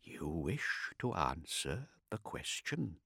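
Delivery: soft, slow, quiet threat; softly. A narrator's reading. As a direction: ask it gently but menacingly. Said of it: "Kartik said softly".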